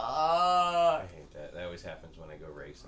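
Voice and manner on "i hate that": slow, loud, drawn-out exasperation; exasperated. Real, not acted. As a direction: stretch out the frustration. Exasperated sympathy.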